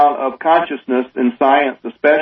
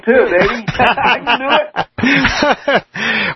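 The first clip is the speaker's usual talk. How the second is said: exaggerated Southern twang, pitched up, laughing through it; 9 semitones higher, much slower than usual. Shown as a demonstration. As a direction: show off a Southern drawl for fun. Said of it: "I got some twang".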